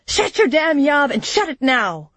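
high, forceful angry order; angry shout. A narrator's reading. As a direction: shout him down. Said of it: "Jaren shouted, grabbing Churik's shoulders".

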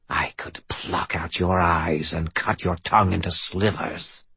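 creeping nightmare whisper; hissing voice. Acted. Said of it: "hissed the voice".

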